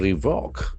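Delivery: Revoke, stressed on VOKE; stress on second syllable. Shown as a demonstration. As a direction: lean on the last syllable of Revoke. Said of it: "Accent falls on second syllable".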